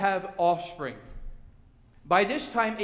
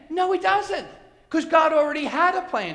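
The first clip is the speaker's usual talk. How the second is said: high, loud preacher's rebuttal; emphatic denial; 8 semitones higher, 6 dB louder than usual. Real, not acted. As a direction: knock the idea down forcefully. Preacher refutes the mock approval.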